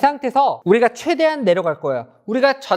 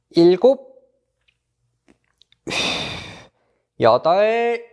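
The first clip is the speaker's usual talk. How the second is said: barking gym coach; much slower than usual. Acted. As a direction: call each count like a gym coach. Coach counting held stretch. (헬스 코치처럼 크게 구령)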